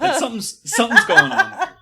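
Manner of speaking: high, loud laughing punchline. Real, not acted. Host's guest joking to the hosts about smoldering glares, laughing high and loud.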